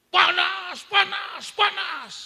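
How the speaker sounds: high, loud cry of panas; screaming. Retold or imitated. Big man under prayer screams, high and loud. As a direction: yell panas as if burning.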